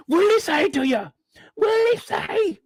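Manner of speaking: high, squeaky imitation of Derek; mock whiny man. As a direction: whine it out in a mocking high voice. Mocking Derek's whiny phone voice.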